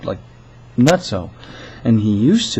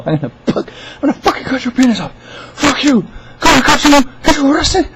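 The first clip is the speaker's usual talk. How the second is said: hammed-up angry yelling, pitched way up; mad caller; 5 dB louder than usual. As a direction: imitate a furious caller screeching threats. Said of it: "he's just mad and pissed off".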